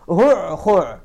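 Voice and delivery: هع، خع clipped into two throat bursts; repeated demo. Shown as a demonstration. Teacher recalling the example word, throat sounds. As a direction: say هع then خع, both from deep in the throat. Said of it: "اسم لما ترعاه الإبل".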